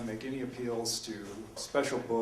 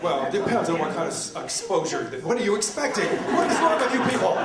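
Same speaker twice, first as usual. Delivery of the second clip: laughing, louder and rising higher through the lines; 5 semitones higher, 9 dB louder than usual. Real, not acted. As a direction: laugh along with the audience's reaction. Audience laughs at his joke.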